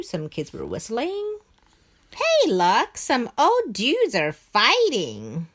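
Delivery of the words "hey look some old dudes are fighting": lightly voiced excited kid. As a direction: call out like a kid. Kids' voices in the story.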